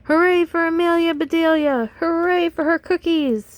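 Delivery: high, loud hooray; happy team cheer. Acted. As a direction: cheer her from the crowd. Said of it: "shouted the boys".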